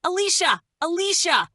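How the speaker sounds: high, loud frantic scream of a name. Acted. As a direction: shriek it out in panic. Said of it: "the woman upstairs screamed my name".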